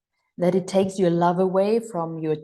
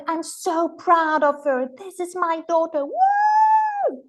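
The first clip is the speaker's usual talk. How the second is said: much higher, gushing with pride and kisses; proud gushing parent; 9 semitones higher than usual. Retold or imitated. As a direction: show off the daughter like the proudest parent alive. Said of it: "And I would go like".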